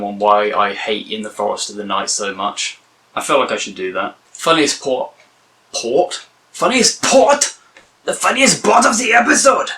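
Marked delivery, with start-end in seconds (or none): 4.3-5.1 "port" said for "part", with a bad German accent; mock German "Funniest port". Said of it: "that was like a bad German accent". 5.7-7.6 baffled repeat. Retold or imitated. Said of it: "that was like a bad German accent". 8.0-9.7 "part" said plainly and clearly, not "port", in a high excited shout; "The funniest part" called out high and loud; excited correction. Real, not acted. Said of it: "that was like a bad German accent".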